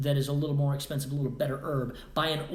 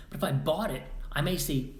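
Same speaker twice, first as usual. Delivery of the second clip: ordinary talk, nothing marked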